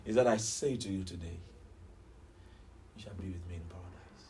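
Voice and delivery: low, very soft voice of dying Jesus; pained promise. Acted. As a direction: fade to a low hush, speaking through pain. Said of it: "in his pain".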